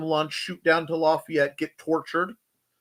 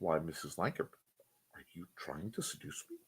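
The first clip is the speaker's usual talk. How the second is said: low soft breathy quote; mock seduction line; 11 semitones lower, 17 dB quieter than usual. Quoting the seduction line, low and soft. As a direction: drop low and hush it.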